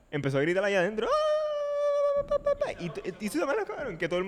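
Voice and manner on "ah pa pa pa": very high shrieking yell imitation; imitated screaming. Man in pink screaming in the coffee shop, imitated shriek.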